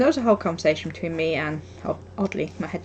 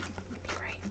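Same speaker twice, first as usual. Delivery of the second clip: whispered to herself, unvoiced; 14 dB quieter than usual. Real, not acted. Crafter whispering to herself that her friend will love it. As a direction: whisper it like convincing yourself.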